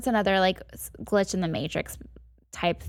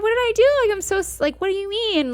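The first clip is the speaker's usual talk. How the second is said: high-pitched baffled pleading; retold "what did I do" bewilderment, pitched high; confused protest; 12 semitones higher, 4 dB louder than usual. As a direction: imitate a bewildered boyfriend, voice jumping way up. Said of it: "so confused".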